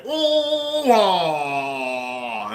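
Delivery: a mock engine blowing up; engine noise imitation. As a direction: voice the motor screaming and dying. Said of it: "and then it went".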